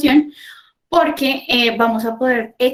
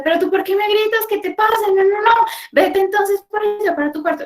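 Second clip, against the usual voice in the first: exasperated parent scolding; 10 semitones higher than usual. Shown as a demonstration. Parent wrongly snapping at a yelling teen, high-pitched scold. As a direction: snap back at the teen in a raised, high voice.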